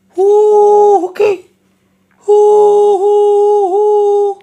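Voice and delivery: very high, long sung howl; jackal howl. A narrator's reading. Jackals howling as they slip down the stream, long and high. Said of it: "असा आवाज करीत".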